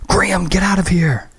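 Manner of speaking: high, urgent order; urgent shout. Acted. Christy ordering Graham out of the wrecked truck, urgent. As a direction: push him out of the truck with your voice.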